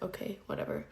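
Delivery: quiet breezy shrug of a line; nonchalant. Retold or imitated. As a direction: brush it off as nothing happened. Nonchalant retold self-quote.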